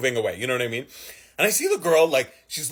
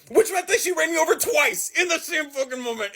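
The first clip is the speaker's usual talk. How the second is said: high outraged disbelief; incredulous outburst; 11 semitones higher than usual. Real, not acted. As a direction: exclaim at the absurdity. Outraged punchline.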